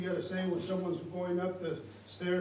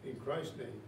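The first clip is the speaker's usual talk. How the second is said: soft, low pastoral prayer voice; 5 semitones lower, 8 dB quieter than usual. Acted. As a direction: pray over the congregation gently. Leads congregation in prayer.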